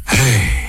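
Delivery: low, weary sigh; long sigh. A narrator's reading. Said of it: "狄仁杰长叹一声".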